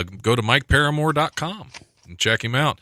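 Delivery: ordinary talk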